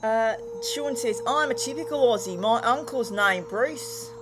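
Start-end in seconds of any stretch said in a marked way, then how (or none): 1.3-4.1 put-on Aussie accent; Australian accent. Streamer reads Sean's chat message in an Australian accent. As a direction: do a jokey, slightly lifted Aussie voice. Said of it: "Sorry about the accent".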